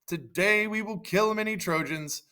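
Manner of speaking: light mock battle speech; acted shout. Achilles rallies the Greeks, high mild shout. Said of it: "And Achilles shouted".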